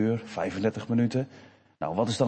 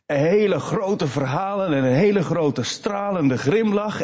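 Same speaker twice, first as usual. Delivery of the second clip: normal talking voice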